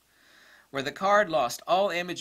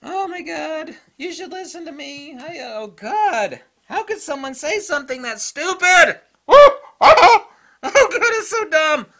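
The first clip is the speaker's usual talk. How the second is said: high, loud, laughing disbelief; overwhelmed by how dumb it is; ranting; 12 semitones higher, 14 dB louder than usual. Real, not acted. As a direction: shriek in exasperated laughter. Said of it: "I had to rant there at the end".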